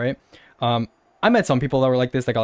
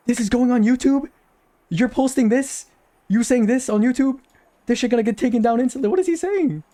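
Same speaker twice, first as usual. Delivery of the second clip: much higher, incredulous outburst; incredulous squeal; 8 semitones higher than usual. Real, not acted. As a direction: squeak your disbelief at him. Incredulous at the troll.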